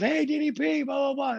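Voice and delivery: high-pitched mock fan hail; excited fan greeting. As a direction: imitate an eager fan calling out. Fan greets him in story.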